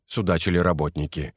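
ordinary delivery

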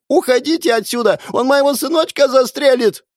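very high, loud wail; wailing woman. A narrator's reading. The hostess wails at the police, her son at gunpoint. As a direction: wail it in terror. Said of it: "заголосила женщина".

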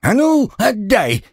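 high furious shout at a thief; angry yell. A narrator's reading. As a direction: yell it out, grabbing back. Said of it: "заорал Гремлун". (сердитый крик)

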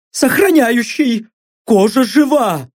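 high, shaky pleading captive; frightened blond. Acted. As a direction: talk fast to save your skin. Blond's voiced answers.

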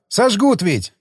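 high, alarmed call; shouted warning. A narrator's reading. Alftan warning the departing commander, shouting. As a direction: call after him in protest. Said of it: "крикнул вдогонку Альфтан".

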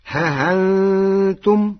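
ههنتم very slowly with a softened hamza; Warsh reading. Shown as a demonstration. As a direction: stretch ههنتم with an eased hamza. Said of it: "فتصير". (ههنتم بتسهيل الهمزة ببطء)

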